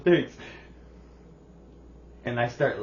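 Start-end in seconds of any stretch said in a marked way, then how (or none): 0.0-0.4 louder, higher "Thanks"; bright quick thank you; mock son's cheerful thanks. Son retold.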